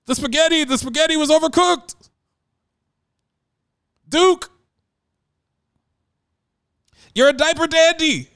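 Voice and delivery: mock broadcaster excitement, pitched high and loud; Dick Vitale voice. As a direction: bellow it like a college hoops announcer at home. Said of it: "he's at home saying".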